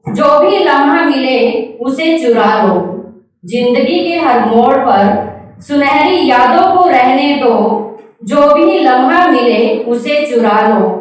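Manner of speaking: lilting, measured verse recital; poem recitation. Acted. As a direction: recite each line rhythmically with a rising and falling tune.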